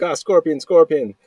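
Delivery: high, loud mock alarm; retold panicked yell. Replaying the scorpion scare for laughs, mock panicked yell. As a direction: yelp it out like a comic panic. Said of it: "I just yell".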